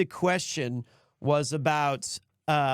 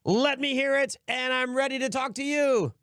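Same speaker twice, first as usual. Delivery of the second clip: higher, hammed-up radio host greeting the caller; 8 semitones higher than usual. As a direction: announce the lucky caller like a cheesy DJ. Plays radio host taking caller.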